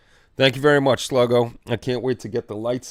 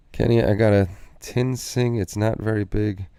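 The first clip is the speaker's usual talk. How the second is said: ordinary talk, nothing marked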